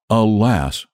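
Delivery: ordinary delivery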